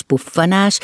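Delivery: usual speaking voice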